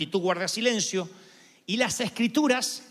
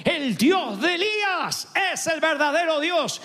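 high, raised chant of a cheering crowd; triumphant acclamation, pitched way up; 8 semitones higher, 3 dB louder than usual. Acted. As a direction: cheer it out like a crowd won over. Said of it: "la gente empezó a hacer porra diciendo".

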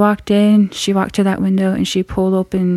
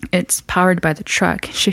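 usual speaking voice

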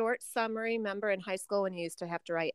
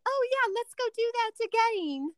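higher, lilting British "again" with a long ay; mock British accent. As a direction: lightly imitate a British friend. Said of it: "when someone would say".

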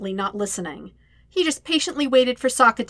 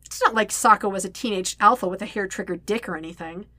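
ordinary delivery

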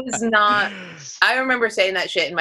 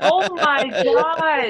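higher, slow drawn-out gasp; real shocked drawl; stunned; 9 semitones higher, much slower than usual. Real, not acted. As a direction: drag it out in disbelief. Reaction to knife story.

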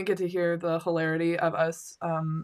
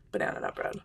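breathy whisper; 8 dB quieter, faster than usual. Pretend. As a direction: whisper it like a secret. Whispering the dessert name.

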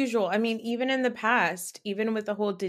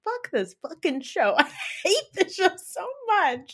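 mock exasperated rant; 10 semitones higher than usual. Acts out turning against the show.

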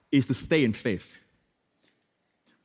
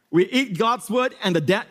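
ordinary talk

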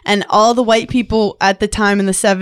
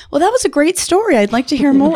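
higher, overly proper polite voice; mock politeness; 6 semitones higher than usual. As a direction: put on a prim, interested listener. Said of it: "'Cause I can't talk to Tracy like".

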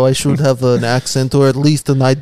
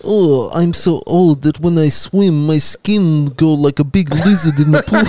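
Dawn Fraser; 3 semitones higher, 3 dB louder than usual. Acted. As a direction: draw the words out as a comic old swimmer. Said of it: "Uh, Dawn Fraser".

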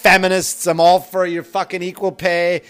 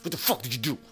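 much softer, gentle asking; soft retold question; 5 semitones lower, 13 dB quieter, faster than usual. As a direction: ask it quietly, not firm. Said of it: "Not as fucking firm as that".

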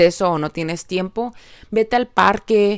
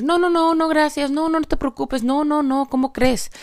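high, fast string of no-no-no; mock flustered refusal; polite refusal of a gift; 9 semitones higher than usual. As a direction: brush off the gift in a much higher voice.

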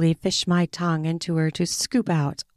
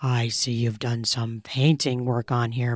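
lower, softer commanding male voice; dominant lover; 7 semitones lower, 5 dB quieter than usual. A narrator's reading. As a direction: growl the order low and close.

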